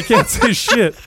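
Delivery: can't stop giggling while saying it; laughing. Real, not acted. Laughing with friends about Springer, high giggly voice.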